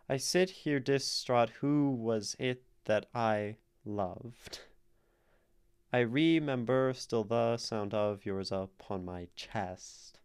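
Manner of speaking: "I sit here distraught" and what follows in slow, stretched alternate beats; "I remember still the sound" plodded out as strict da-DUM; forced iambic reading. Shown as a demonstration. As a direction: lean on every second syllable from "I sit here distraught" to "upon my chest". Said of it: "if we try to read it iambically".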